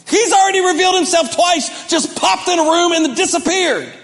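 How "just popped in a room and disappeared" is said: normal talking voice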